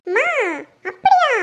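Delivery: very high, loud exclaimed question; loud, high-pitched disbelief; shocked surprise. Acted. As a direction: let the voice leap up in astonishment. Daughter astonished by mother's cover story.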